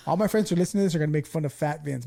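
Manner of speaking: ordinary talk, nothing marked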